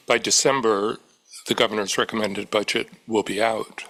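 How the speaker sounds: ordinary talk, nothing marked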